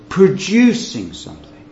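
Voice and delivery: normal talking voice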